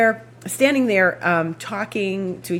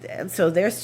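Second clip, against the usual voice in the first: ordinary talk, nothing marked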